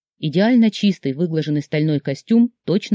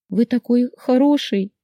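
high, narrated sob; tearful; 7 semitones higher than usual. A narrator's reading. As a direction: say it as if holding back sobs. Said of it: "всхлипнула я". (всхлипывая, тонким голосом)

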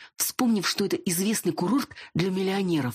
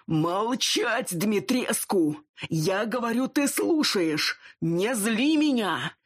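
higher, slow furious order; angry boss; 6 semitones higher, much slower than usual. Acted. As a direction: drag out each angry word. Werewolf chief yelling at subordinate.

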